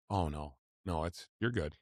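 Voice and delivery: soft, reassuring doctor; a quiet reassuring doctor. Retold or imitated. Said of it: "the doctor goes".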